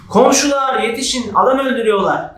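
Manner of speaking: panicked call. Shown as a demonstration.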